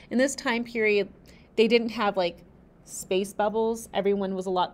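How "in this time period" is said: ordinary delivery, nothing marked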